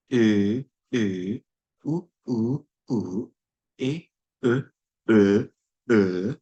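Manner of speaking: each vowel e, u, o held and set apart; vowel demo. Shown as a demonstration. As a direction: sound out the vowel inventory one by one. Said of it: "this is the combined phonemic inventory".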